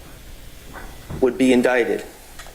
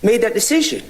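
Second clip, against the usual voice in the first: insistent argument; 9 semitones higher, faster than usual. Real, not acted. Lawyer arguing insistently with judge.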